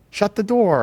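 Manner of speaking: high, loud sample command; demonstrated shout. Shown as a demonstration. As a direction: call it out forcefully, pitched well up. Examples of recorded emotional phrases.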